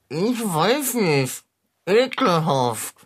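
slow, muffled words round a mouthful; onion in mouth. Acted. Cat repeats for puzzled mechanic with mouth full, garbled. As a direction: repeat it thickly, consonants smothered by onion. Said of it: "mit der Zwiebel im Mund sprichst du sehr undeutlich".

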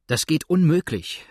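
upset exclamation, pushed out loud; cried out. A narrator's reading. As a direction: burst out against the idea. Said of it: "rief Tom".

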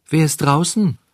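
high, small creature calling; fine little voice. A narrator's reading. Tiny cricket calls to strangers outside, fine voice. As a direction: call out in a thin high voice. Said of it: "rief eine feine Stimme".

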